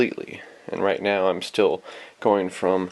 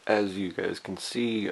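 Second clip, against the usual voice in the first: ordinary talk, nothing marked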